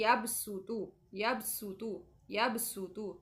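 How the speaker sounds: यबसुतु with a light, thin s, not heavy; यबसुतु with a whistling seen; thin seen sound. Shown as a demonstration. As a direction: say यबसुतु with a hissing seen, not a thick sad. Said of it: "इसको सीन की आवाज़ निकालनी है".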